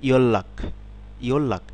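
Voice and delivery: ইয়ল্লাক with a doubled l, the n turned to l; Korean liquid assimilation. Shown as a demonstration. Teacher modelling ইয়ল্লাক for students, ending with a falling tune. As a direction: say ইয়ল্লাক as one word with a long ll. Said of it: "শব্দে যখন পড়ব তখন হবে".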